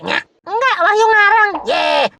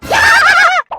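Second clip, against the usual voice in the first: high, loud shriek of surprise; startled yell; 4 dB louder than usual. Acted. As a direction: yelp as if caught gossiping. Startled by Pak RT turning up.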